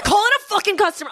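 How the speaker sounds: correcting the salon's sailor talk, annoyed and fast; exasperated. Retold or imitated. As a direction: snap back at the nail tech. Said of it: "I'm like".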